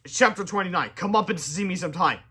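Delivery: ordinary delivery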